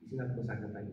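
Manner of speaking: normal talking voice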